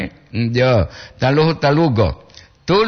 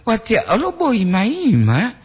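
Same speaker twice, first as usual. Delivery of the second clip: hopeful, high-pitched swoop; high, pleading hopeful intonation; full of hope; 7 semitones higher than usual. Shown as a demonstration. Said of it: "Intonasi dia itu penuh harap".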